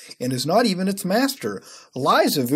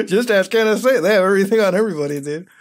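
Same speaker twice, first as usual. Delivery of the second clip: higher, louder, through laughter; laughing; 4 semitones higher, 4 dB louder than usual. Real, not acted. Repeating a funny comment to viewers, laughing. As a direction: repeat it while cracking up.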